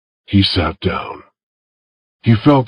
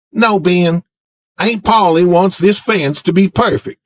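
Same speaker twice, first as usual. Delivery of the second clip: put-on higher, boyish voice for Tom; Tom asking Jim; 9 semitones higher than usual. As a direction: coax Jim in a raised boy's voice. Tom refusing Ben.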